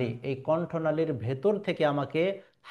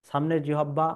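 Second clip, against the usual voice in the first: ordinary talk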